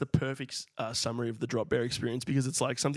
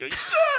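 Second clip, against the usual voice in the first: high, loud imitated squeal of fright; a sudden pitched-up shriek; mock kids' scream; 4 dB louder than usual. Kids reacting to drop bear news.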